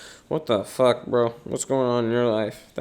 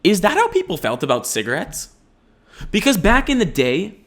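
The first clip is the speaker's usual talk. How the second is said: ordinary talk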